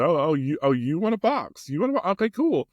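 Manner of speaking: high-pitched playful taunt; mock challenge. Dad taking up his child's challenge to box, playful mock taunt.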